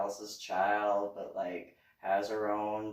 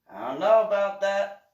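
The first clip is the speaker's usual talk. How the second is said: louder and higher dubious I don't know about that; skeptical retort; 7 semitones higher, 12 dB louder than usual. Retold or imitated. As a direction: sound openly doubtful. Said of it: "it was just one of those".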